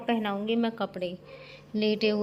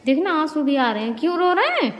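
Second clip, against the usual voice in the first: raised voice, climbing high on the question; loud concerned questioning; 6 semitones higher, 6 dB louder than usual. Real, not acted. Caregiver to crying papa, loud concerned questioning. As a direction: press loudly for an answer.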